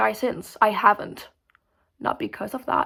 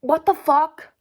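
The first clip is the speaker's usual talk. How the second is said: higher, louder exclaim; loud disbelief; 6 semitones higher, 7 dB louder, slower than usual. Real, not acted. Ranting to the viewers about the movie, a loud shocked blurt.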